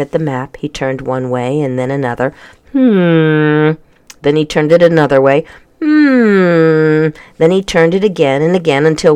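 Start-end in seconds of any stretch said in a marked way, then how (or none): 2.7-7.1 musing hmm; puzzled old Scot humming; thoughtful hum. A narrator's reading. Man puzzles over map.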